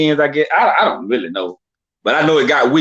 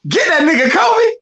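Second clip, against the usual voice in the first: hype cry; 13 semitones higher than usual. Real, not acted. Hyping Kobe, voice cracking high.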